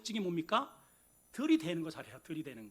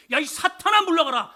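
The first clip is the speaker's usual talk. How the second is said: loud, higher furious rebuke; harsh rebuke; 8 semitones higher, 13 dB louder than usual. Retold or imitated. Preacher imagines the congregation being rebuked, shouting angrily. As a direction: hurl it at the listener as an insult. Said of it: "라고 여러분들이 들었다고 칩시다".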